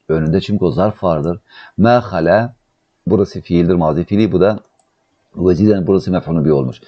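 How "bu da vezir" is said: normal talking voice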